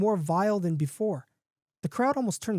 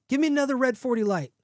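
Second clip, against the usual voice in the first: mild raised-pitch yell; 6 semitones higher, faster than usual. A narrator's reading. Man to the bartender, mildly yelled order. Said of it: "The man yells at the bartender".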